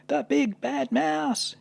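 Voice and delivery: very high, slow, drawn-out cry of fright; frightened yell. Acted. Gruffalo's child spotting the giant shadow, high frightened yell. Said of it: "yelled the Gruffalo's child".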